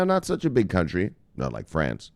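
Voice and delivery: normal talking voice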